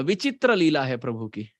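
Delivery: ordinary delivery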